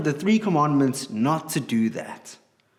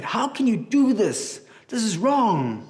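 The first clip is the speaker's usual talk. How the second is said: upset; 7 semitones higher than usual. Acted. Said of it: "he was so upset".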